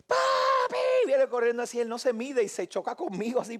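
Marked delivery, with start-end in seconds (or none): 0.1-1.1 very high, drawn-out squealed call; child's yell. Retold or imitated. Son calling from across the house, screaming happily. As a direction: yell for Dad like an excited little boy. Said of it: "pero gritando".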